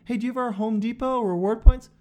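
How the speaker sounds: eager hardware-store upsell; loud clerk pitch. Retold or imitated. Home Depot clerk pushing points, loud and high. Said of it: "Did you hear the difference?".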